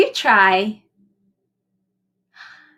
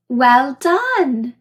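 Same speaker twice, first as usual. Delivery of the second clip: usual speaking voice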